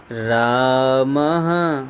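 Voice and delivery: रामः drawn out very slowly and low; slow dictation. Shown as a demonstration. Teacher dictating the answer word to students, very slowly. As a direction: dictate रामः as if the class is writing it. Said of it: "यही copy करो पूरा".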